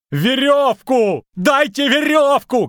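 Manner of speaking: very high, stretched frantic shout; loud desperate holler. A narrator's reading. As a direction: scream for help, dragging out the vowels. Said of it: "кричал Лютик".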